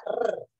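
"R" as a bare rolled trill; strong Spanish R. Shown as a demonstration. Teacher isolates Spanish R, strongly rolled. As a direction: trill the tongue for "R", no vowel. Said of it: "Pronuncio bastante la R".

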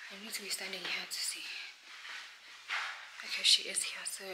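real soft whisper. Real, not acted. Upstairs waiting for the client, whispering. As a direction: whisper the plan to herself. Watching gate from upstairs, hushed.

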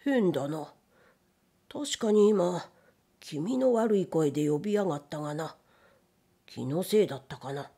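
puzzled gatekeeper muttering to himself; uneasy muttering. A narrator's reading. Nervous gatekeeper peering out of the window, muttering uneasily to himself. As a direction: mutter low and slightly spooked, trailing down. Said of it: "キョロキョロあたりを見回しながらつぶやきました".

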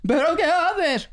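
high, slow drawn-out disbelief; mock baffled. Crew baffled by the silent shout, mock drawn-out disbelief. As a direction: stretch it out, baffled. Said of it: "la gente estaba esperando en plan".

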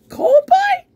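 sudden very high, squeaky mocking echo; mock squeaky disbelief. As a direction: squeak the question in fake outrage.

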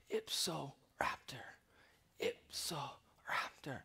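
faint, slow whispered last words; dying mutter. Acted. Wounded man dying after a supposed construction accident, muttering. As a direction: whisper it weakly, with gaps between the words. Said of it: "he mutters this phrase".

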